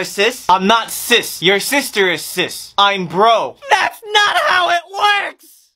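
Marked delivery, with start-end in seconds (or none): 3.7-5.7 slow, high wailing protest; crying shout. Acted. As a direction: wail it through tears.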